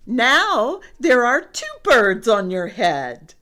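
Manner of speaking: loud, high proclamation; piggy announcing with gusto; excited announcement. A narrator's reading. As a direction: declare the big news brightly and loudly. Piggy announces two birds.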